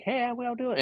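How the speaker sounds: very high, soft mock blurt; tossed off high and light; random blurt. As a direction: imitate a sudden offhand decision in a squeaky-high voice. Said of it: "randomly was like".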